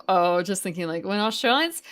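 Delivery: usual speaking voice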